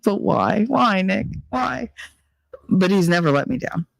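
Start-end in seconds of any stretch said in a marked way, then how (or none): 0.0-1.9 high, slow, pleading whine; drawn-out mock despair at a friend; whiny, slow but why; exasperated plea.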